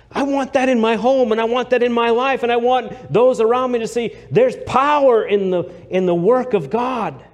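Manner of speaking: high, loud preaching cry; preaching at full force. Acted. Preacher crying out to his congregation, loud and high. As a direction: lift it up and shout it to the rafters.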